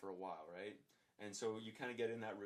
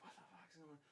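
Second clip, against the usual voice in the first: hushed alarm; 5 semitones higher, 14 dB quieter, faster than usual. Retold or imitated. Coworkers arrive at the scene.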